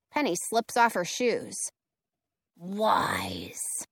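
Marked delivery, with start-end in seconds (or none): 2.6-3.9 whispered with quiet menace; menacing whisper. A narrator's reading. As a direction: breathe it like a threat. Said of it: "her voice dripping with menace".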